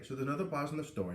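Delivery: ordinary delivery, nothing marked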